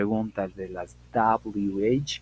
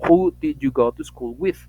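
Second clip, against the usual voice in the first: slow, much louder English question; slow model question; 3 semitones higher, 10 dB louder, slower than usual. Shown as a demonstration. Teacher modelling the finished wh-question, slowly and loud. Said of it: "y digo".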